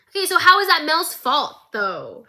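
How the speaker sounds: loud, high outraged question; real exasperation at the villain; indignant. Real, not acted. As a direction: demand an answer, appalled. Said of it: "outraged at Ambessa".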